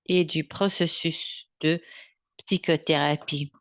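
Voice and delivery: ordinary talk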